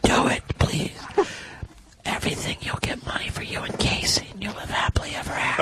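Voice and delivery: soft eerie demon whisper; scary demon voice. Pretend. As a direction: whisper like a tempting devil. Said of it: "you're doing the scary demon voice".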